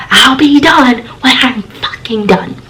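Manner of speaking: high, slow, drawn-out imitation of a yell; mock shout. Roommate shouts from the shower, high mock yell. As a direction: imitate an irate roommate, stretching the words without real volume. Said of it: "She shouts out".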